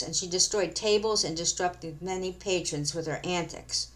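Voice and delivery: plain reading voice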